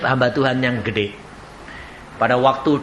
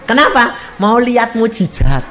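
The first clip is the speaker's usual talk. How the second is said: normal talking voice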